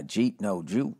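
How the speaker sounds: "Jeet" clipped from did you eat; Boston accent. Shown as a demonstration. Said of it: "Did you eat? No, did you?".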